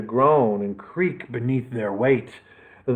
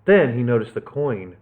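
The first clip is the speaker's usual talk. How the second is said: usual speaking voice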